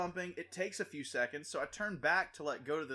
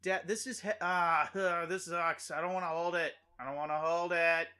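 put-on high, loud whining child nagging his dad; whiny kid; 6 semitones higher, 6 dB louder than usual. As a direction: whine like a tired little boy. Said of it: "And the kid's like".

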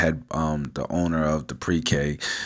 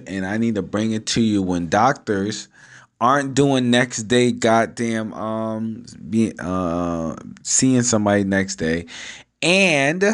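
ordinary delivery

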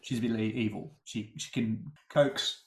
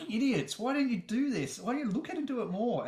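higher-pitched, playfully berating; mock scolding listener; 10 semitones higher than usual. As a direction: tease like an annoyed know-it-all. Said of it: "they're like".

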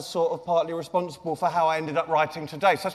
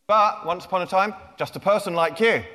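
ordinary delivery

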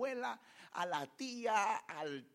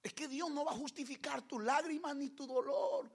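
ordinary talk, nothing marked

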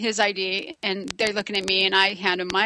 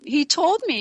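normal talking voice

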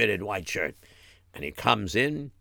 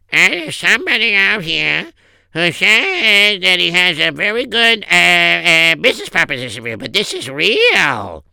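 higher, stretched-out words to the boss; secretary reporting; 6 semitones higher, 6 dB louder than usual. Acted. Secretary buzzes her boss.

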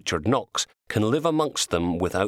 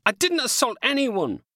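indignant shout. A narrator's reading. Knox denying arrest charge.

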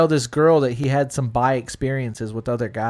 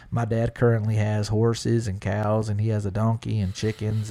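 put-on a lightly done Texas country accent, lower; 4 semitones lower, 3 dB quieter than usual. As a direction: play a polite Texas country boy. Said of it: "my country hick accent".

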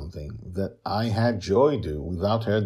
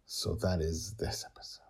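whispered aside; 9 dB quieter than usual. Real, not acted. Host wrapping up the episode, soft whisper. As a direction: breathe it out in a whisper.

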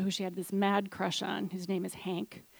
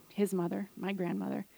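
ordinary talk, nothing marked

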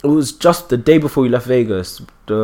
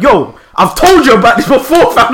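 higher, louder telling-off; exasperated, raised-voice ticking-off; mock scolding; 10 semitones higher, 5 dB louder than usual. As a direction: tell off a younger lad in exasperation. Acted scolding of a younger.

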